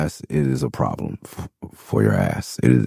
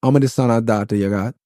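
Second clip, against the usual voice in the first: put-on Jamaican patois accent; 5 semitones higher than usual. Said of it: "And the man said".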